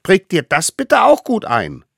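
high, eager little voice; tiny eager hunter. Acted. Little hunter brings food to the mammoth, high and eager. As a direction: play the smallest one, proud and keen. Allerkleinste insists.

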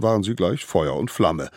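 usual speaking voice

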